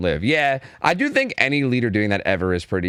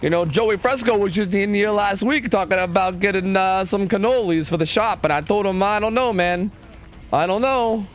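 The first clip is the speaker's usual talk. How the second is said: put-on higher, thick New York Italian mobster accent; 7 semitones higher than usual. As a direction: play a chatty New York Italian wiseguy. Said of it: "the damn New York accent".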